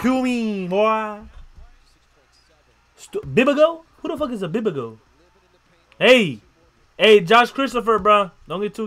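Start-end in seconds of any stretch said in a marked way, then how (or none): none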